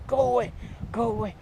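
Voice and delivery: imitating an annoyed shopkeeper; grumpy shout. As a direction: chase them off from the window. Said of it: "He goes".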